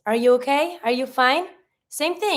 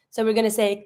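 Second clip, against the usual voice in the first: ordinary talk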